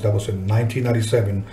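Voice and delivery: ordinary delivery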